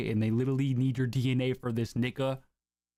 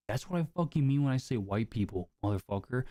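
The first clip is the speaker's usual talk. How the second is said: ordinary delivery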